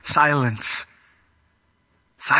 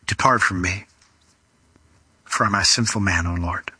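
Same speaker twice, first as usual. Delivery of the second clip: low, subdued plea with long pauses; humbled confession; 8 semitones lower than usual. Acted. Peter fallen at Jesus' knees, low humbled confession. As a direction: lower the voice and confess it haltingly, fading at the end.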